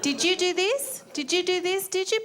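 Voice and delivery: high sing-song mother; sweet mum voice. Retold or imitated. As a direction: coo it like a doting mum.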